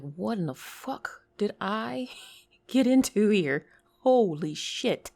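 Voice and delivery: retold shock with a laugh breaking in; can't believe where you've landed; acted disbelief. Acted shock at strange class.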